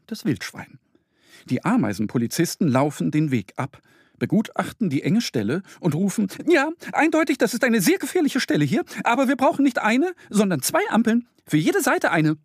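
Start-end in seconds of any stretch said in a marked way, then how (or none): 6.5-12.4 very high, fast ant squeak; tiny ant police calling. Acted. Ant police announcing themselves to Mo, squeaky call. Ants inspect the path.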